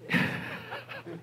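high burst of laughter. Real, not acted. Pastor teasing Liz in the congregation, laughing.

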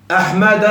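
"أحمد" said as "Ahmada", ending on a; fatha without tanween. Shown as a demonstration. Teacher demonstrating case endings, "أحمد" with bare final "-a". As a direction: end "أحمد" on an open a, no n. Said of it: "فتحة بدون تنوين".

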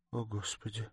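hushed, helpless "oh Lord"; escaped exclamation. A narrator's reading. Accused girl before staring onlookers, a hushed involuntary cry. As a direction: let it slip out very softly. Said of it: "вырвалось у Сони".

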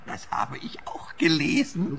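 whispered aside; whispering. Acted. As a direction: whisper it behind her back. Aside while suspect present.

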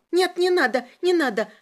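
high panicked begging; high-pitched alarm; frightened pleading. A narrator's reading. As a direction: beg hurriedly in a high voice. Prisoner begs not to be hurt.

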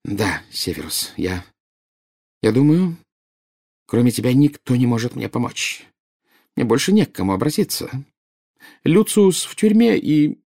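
halting, increasingly high plea; acted despair, pitch climbing as words falter. A narrator's reading. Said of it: "полные отчаяния". (с отчаянием, запинаясь)

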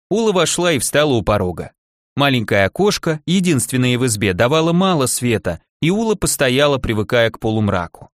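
ordinary delivery

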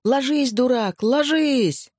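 high, howling cry of warning; wailing yell. Acted. Someone on the hill howls at Vadim to lie down, high wail. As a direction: wail at him to get down. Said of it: "Чей-то воющий голос".

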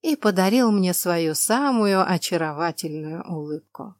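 ordinary delivery

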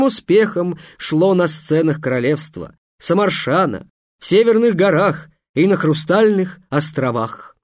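booming circus ringmaster. Acted. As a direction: proclaim it to a big crowd, slowed on titles. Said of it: "торжественно сказал". (громкий торжественный конферансье)

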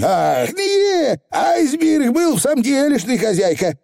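squeaky little Scottish pixie; high, rough tiny rascal; Feegle voice. Acted.

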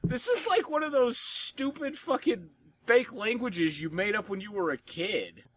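a high-pitched scoffing voice; mock exasperation. Real, not acted. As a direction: scoff at it in disbelief. Exasperated rant about slang.

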